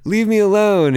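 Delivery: high, loud pleading yell; desperate shout. Retold or imitated. Friend begging the women to back off, shouting. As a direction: yell it like you're cornered. Repeats friend's plea, much louder.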